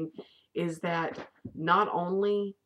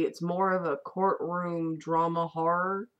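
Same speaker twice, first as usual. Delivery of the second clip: normal talking voice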